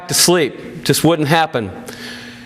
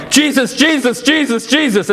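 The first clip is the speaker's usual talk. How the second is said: fast, much higher cry of a name; frantic yell; 9 semitones higher than usual. A narrator's reading. Daughter crying out beside her wounded father, frantic and much higher. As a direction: call out as quickly as your tongue can. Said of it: "I was yelling".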